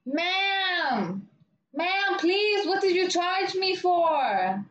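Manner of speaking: high, drawn-out mock pleading; insistent customer. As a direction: imitate her begging for an answer. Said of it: "She just keeps going".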